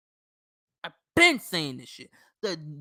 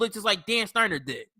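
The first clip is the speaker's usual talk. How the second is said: normal talking voice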